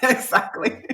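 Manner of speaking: laughing. Real, not acted. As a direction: laugh then the word through it. Laughing at friend's quip.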